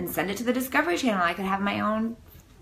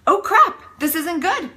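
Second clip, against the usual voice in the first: 1 alarmed doctor; 8 semitones higher, 4 dB louder than usual. Pretend. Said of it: "I need a doctor that goes".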